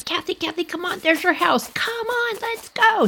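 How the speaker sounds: high, hushed frantic pleading; urgent urging. Retold or imitated. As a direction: imitate a kid urging a friend to run. Retold urging friend while fleeing.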